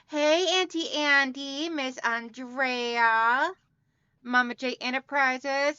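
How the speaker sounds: normal talking voice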